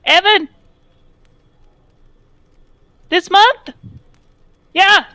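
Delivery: loud, high guard's holler; shouting across. Acted. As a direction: yell to a colleague far off. Guard shouts question to a colleague.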